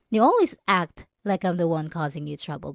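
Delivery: normal talking voice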